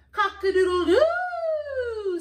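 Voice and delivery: high, loud, drawn-out crow; storyteller's full-voiced rooster call. A narrator's reading. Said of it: "says the rooster".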